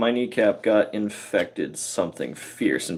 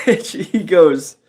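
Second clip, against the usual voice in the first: ordinary delivery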